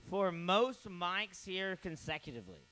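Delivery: usual speaking voice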